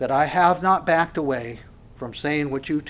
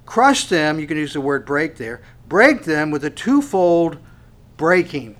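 preaching at full force; 3 semitones higher, 8 dB louder than usual. Acted. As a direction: thunder the verse out.

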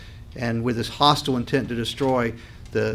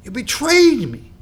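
high, slow, louder wounded cry; anguished God; 11 semitones higher, 5 dB louder than usual. Retold or imitated. God accusing his people, wounded and slow. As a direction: let the betrayal ache, drawn out up high. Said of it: "you can hear that in God's voice".

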